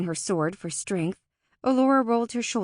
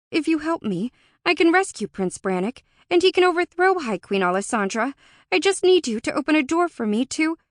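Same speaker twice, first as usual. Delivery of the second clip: higher, louder, urgent bargaining; pleading Alora; 8 semitones higher, 6 dB louder than usual. A narrator's reading. Alora asks the hostile Fae for a door, urgently pleading. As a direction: plead insistently, leaving the last word hanging.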